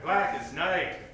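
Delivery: normal talking voice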